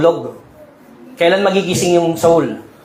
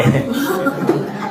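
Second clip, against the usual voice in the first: airy laugh; 9 semitones higher, 4 dB quieter than usual. Real, not acted. Pastor laughs at own joke.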